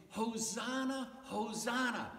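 high, restrained cry of praise; mild cheer. Acted. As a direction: call it out as a gentle cheer. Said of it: "people were cheering like God had".

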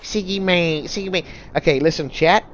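high, loud hammed-up Australian accent; drawn-out mock-Australian storytelling, pitched high; attempted Australian accent. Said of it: "I can't do an Australian accent".

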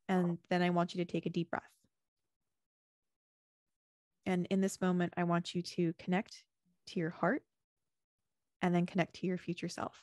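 a hint of meditation guide voice; guided visualization. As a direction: lead them gently inward with closed eyes. Leads a closing visualization.

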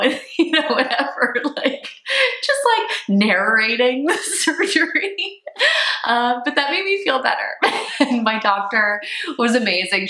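giggling through the words, pitch up; laughing. Real, not acted. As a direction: laugh as you tell it. Laughing while recounting surgery.

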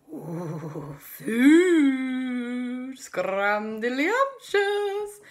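high, loud hungry raptor voice; drooling dinosaur, slow on scrumdiddlyumptious; greedy raptor. Acted. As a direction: relish the word food like a hungry beast. Hungry velociraptors spot the kids.

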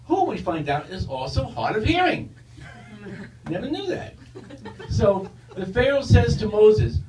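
ordinary delivery

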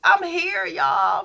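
real tearful rise, holding back sobs; close to tears. Real, not acted. Telling viewers about fourteen years, on the verge of tears. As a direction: voice rising high and slow, near crying. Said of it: "I feel like crying".

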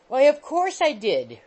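high, loud exasperated retort. A narrator's reading. Young man losing patience with the landlady. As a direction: snap back impatiently.

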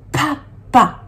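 papa in two detached syllables; papa dictated beat by beat. Shown as a demonstration. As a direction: break papa into pa, pause, pa. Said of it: "deux fois la même syllabe".

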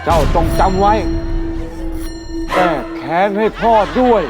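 low echoing ghost voice; ghostly echo. Acted. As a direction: call from beyond with echo. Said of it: "พ่อพูดกรอกหูผมว่า".

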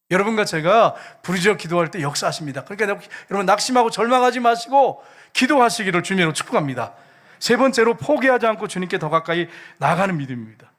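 rousing preacher's blessing; forceful preaching. Real, not acted. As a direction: drive the exhortation home to the congregation.

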